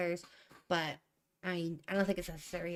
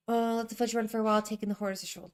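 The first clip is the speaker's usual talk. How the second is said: fast reread; 3 semitones higher, 4 dB louder, faster than usual. Shown as a demonstration. Rushed reread of draft.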